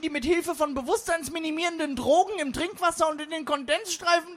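high, frantic crank voice; conspiracy theorist. Acted. Conspiracy theory warns the meeting of lizard people, frantic. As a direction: rant in a high voice, building to a hysterical shout. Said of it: "ergriff als erstes die Verschwörungstheorie wieder das Wort".

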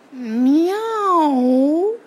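long, high, drawn-out meow; cat meow. Acted. Cat puzzles over the strange bird, drawn-out meowing. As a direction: stretch one meow like a pondering cat.

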